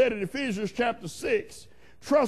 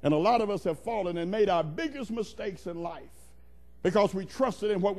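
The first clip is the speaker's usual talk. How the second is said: ordinary delivery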